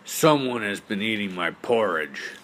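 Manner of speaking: loud, booming papa bear; Papa Bear's big voice. Acted. Papa Bear finds his porridge eaten, big loud voice. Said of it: "in his great big voice".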